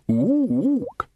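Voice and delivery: high ape hoot; two-note hoot of approval; appreciative ape. A narrator's reading. Librarian hoots over the organ's din, appreciative. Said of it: "of appreciation".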